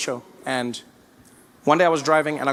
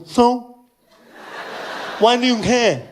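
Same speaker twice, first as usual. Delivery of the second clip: higher, thick-tongued challenge; imitated blurred, dismissive retort; partially deaf fan; 5 semitones higher, slower than usual. Deaf fan brushes off complainers, mimicked voice. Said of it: "he was partially deaf".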